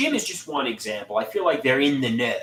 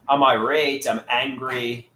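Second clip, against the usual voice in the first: ordinary talk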